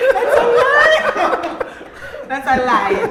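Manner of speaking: loud, laughing protest; laughing denial. Real, not acted. Actor laughingly denies castmate's claim, loud and amused. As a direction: deny it while cracking up.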